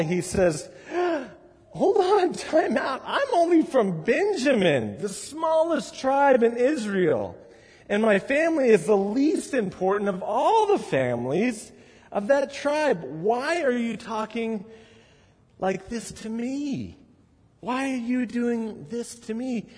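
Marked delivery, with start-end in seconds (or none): 1.7-13.0 much higher, protesting voice; startled Saul. Retold or imitated. Saul objecting to Samuel, high startled protest. 13.0-19.7 high, softer bewildered plea; pleading Saul. Retold or imitated. Saul asking Samuel why, high soft bewildered plea.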